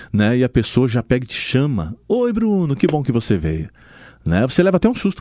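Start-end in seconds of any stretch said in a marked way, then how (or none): 2.1-3.7 cheerful greeting. Retold or imitated. Receptionist remembers client's name.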